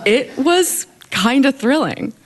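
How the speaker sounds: amused, laughing through it; a laugh as she admits it. Real, not acted. Laughing while admitting excitement.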